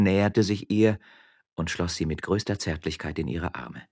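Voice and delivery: ordinary delivery, nothing marked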